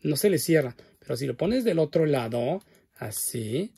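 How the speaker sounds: ordinary delivery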